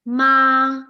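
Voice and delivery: "ma" held long on one steady level tone; first tone. Shown as a demonstration. Teacher shows student the first tone, constant "ma". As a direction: say "ma" drawn out, pitch constant. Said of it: "speak in all the four tones".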